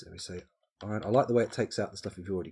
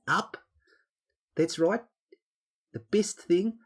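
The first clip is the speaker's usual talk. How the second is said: high-pitched, loud mock Kiwi; New Zealand accent; 9 semitones higher, 4 dB louder than usual. Shown as a demonstration. Streamer teaching the Kiwi vowel trick, in a New Zealand accent. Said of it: "do a New Zealand accent".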